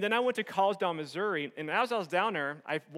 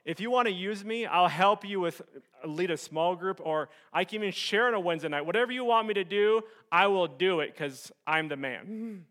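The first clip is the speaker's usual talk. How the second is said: boastful offer; 3 semitones higher, 7 dB louder than usual. Retold or imitated. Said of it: "this is what I told him".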